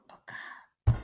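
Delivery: a hushed whisper to God mid-prayer; whispered aside to God in a desperate prayer; whispered plea. Pretend. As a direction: breathe it like a secret prayer. Whispered plea inside acted prayer.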